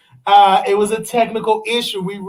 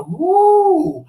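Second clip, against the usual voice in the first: high whooping cheer; radio host's gleeful woo; excited whoop; 9 semitones higher than usual. Real, not acted. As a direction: whoop it up on air.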